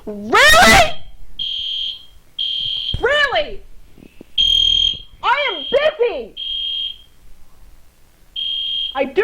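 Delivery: high, exasperated yelling at the noise. Real, not acted. Fire alarm going off mid-game.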